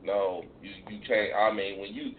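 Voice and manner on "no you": ordinary delivery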